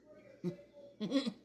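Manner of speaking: high amused giggle; laughing. Real, not acted. Vlogger laughing at picturing Olivia, giggling. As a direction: burst out giggling at the thought.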